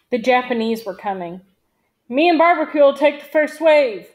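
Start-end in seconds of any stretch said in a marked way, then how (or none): 2.1-4.1 raised, higher-pitched squad leader's yell. A narrator's reading. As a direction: call out orders loudly over combat. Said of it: "Big John yelled".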